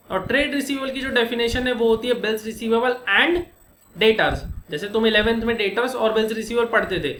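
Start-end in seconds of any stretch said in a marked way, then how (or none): none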